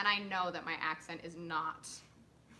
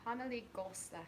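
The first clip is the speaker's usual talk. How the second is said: German accent; 8 dB quieter than usual. Shown as a demonstration. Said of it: "I did German for a film".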